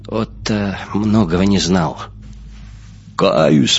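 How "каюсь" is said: low, firm bass voice; deep bass confession. Acted. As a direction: drop down into a bass to admit it. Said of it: "пробасил Устюжин от окна".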